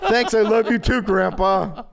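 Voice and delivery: high, laughing sarcastic thanks; mock gratitude through laughter; laughing sarcasm.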